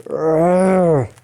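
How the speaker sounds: acted "urgh" of frustration; pissed-off growl. A narrator's reading. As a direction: growl it out, long and annoyed. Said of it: "Dent is very pissed".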